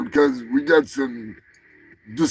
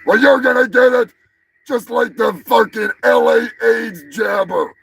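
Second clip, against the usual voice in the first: quoted yell; 7 semitones higher, 5 dB louder than usual. Retold or imitated. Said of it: "where I stole that line from".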